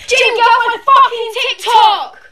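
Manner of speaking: high, loud furious yell; angry yell. Real, not acted. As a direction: yell at the uncle to leave. Repeat of the uncle yell.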